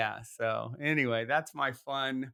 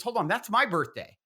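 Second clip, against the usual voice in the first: higher, louder astonished interjection; retold surprise; 9 semitones higher, 5 dB louder than usual. Friend hears the date of her birthday, retold surprise. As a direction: imitate a friend suddenly struck by a coincidence.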